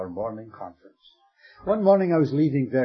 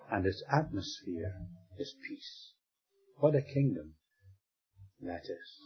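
hushed; 5 semitones lower, 9 dB quieter than usual. Acted. Preacher hushes on peace.

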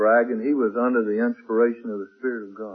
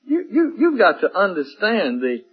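higher, louder and slowed, building the vision; impassioned preaching; 11 semitones higher, 4 dB louder than usual. Acted. Preacher pictures the listener called before the Lord, rising fervor. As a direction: pull them into the scene, rising.

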